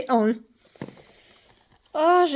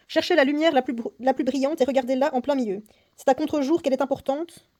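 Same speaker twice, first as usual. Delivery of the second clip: louder, lively reading of the game text; story reading; 6 dB louder than usual. Acted. Player reading the escape game story aloud, theatrical narration. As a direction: read the booklet like a dramatic tale. Reads next clue aloud.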